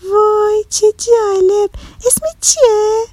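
put-on very high, drawn-out girlish delight; excited. A narrator's reading. Girl delighted by the island of opposites, excited. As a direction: marvel in a high, slow, excited child's voice. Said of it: "با هیجان گفت".

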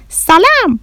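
high, bright-pitched call-out; loud greeting. Acted. Bahram greeting grandmother on seeing her, loud. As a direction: belt out a hello, high-pitched. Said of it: "با صدای بلند گفت".

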